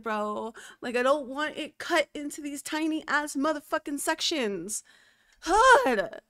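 loud, frustrated ranting; real frustrated shout building to a loud God; angry rant. Real, not acted. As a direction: vent it loudly at the server. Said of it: "I needed to rant and, and scream for a second".

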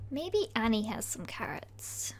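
hushed, sneaking whisper; sneaky whisper. Pretend. Player creeping into Annie's farm, whispering.

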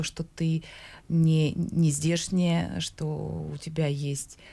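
ordinary delivery

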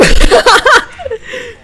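high burst of laughter; loud laugh. Real, not acted. Big laugh at a joke.

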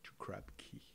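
hushed, unvoiced whisper; dramatic whisper. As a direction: whisper it like a spooky reveal. Dramatic build to the villain's island.

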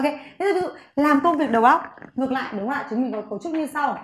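usual speaking voice

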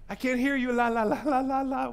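high singsong la-la; childish refusal to listen. Acted. Sinner ignoring warnings, childish singsong refusal. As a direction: drown them out in mock singsong.

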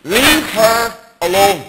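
put-on Irish accent rebuke. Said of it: "with an Irish accent".

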